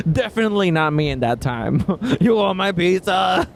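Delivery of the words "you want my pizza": mock-drunk "You want my pizza?" with a heavier accent; drunk reenactment. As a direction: replay the drunk offer with a thicker accent. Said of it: "I don't even think I said it right".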